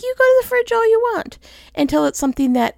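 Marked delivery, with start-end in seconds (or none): 0.0-1.4 high, fast, cheeky dog voice; playful pet voice, pitched high and quick; voicing the dog. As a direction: squeak it out quick as the dog talking. Dog's imagined voice.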